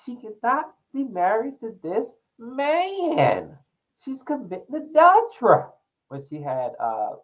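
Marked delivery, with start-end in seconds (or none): 0.0-5.6 mock-outraged pronouncement; quoting T S Madison. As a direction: proclaim it like a scandalized church lady. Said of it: "T S Madison says".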